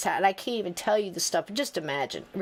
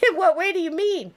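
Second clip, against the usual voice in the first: asking through a real laugh, pitched up; laughing question; 10 semitones higher, 4 dB louder than usual. Real, not acted. Speaker quoting herself to the reader, laughing. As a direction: giggle the words out as she asks.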